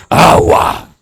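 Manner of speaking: voiceless, rasped demand for water; possessed voice. Acted. Possessed person's request, rasped. (voz de poseído, susurro fuerte)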